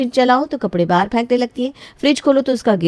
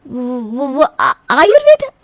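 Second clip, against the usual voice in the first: flustered stammer; 2 semitones higher, 4 dB louder, much slower than usual. Acted. Bride caught, improvising an excuse.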